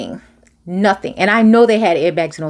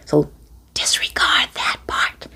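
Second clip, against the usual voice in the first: whisper; 5 semitones lower, 5 dB quieter than usual. Real, not acted. Aside about train noise.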